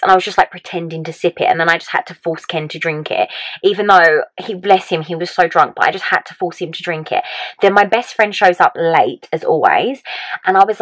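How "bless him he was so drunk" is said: usual speaking voice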